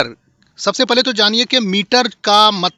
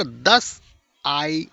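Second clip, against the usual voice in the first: "दस" quick, then "आई" drawn out; stressed second syllable; 6 semitones lower, much slower than usual. Shown as a demonstration. Teacher scanning the poem's first foot, stress on "आई". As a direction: pause after "दस" and stretch "आई". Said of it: "आई यहाँ पर खींच रहे हैं".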